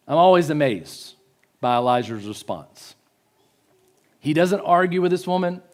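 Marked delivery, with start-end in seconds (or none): none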